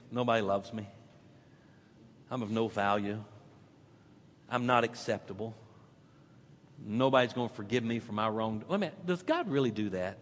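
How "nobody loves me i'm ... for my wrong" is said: much softer, low inner voice; despairing thought. Retold or imitated. Listener imagined thinking, softly hopeless. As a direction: murmur it to yourself, defeated. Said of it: "you're thinking".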